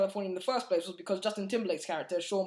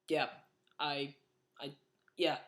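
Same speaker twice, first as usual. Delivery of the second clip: a lower, softer, halting mumble with long pauses; awkward nerd; 4 semitones lower, 4 dB quieter than usual. Retold or imitated. Reviewer imitating Mark talking to girls, awkward halting mumble. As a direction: imitate a socially awkward guy stalling. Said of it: "That's the kind of vibe he was giving me".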